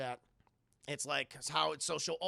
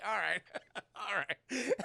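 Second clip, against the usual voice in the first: laughing; 2 semitones higher, slower than usual. Real, not acted. Laughing reply.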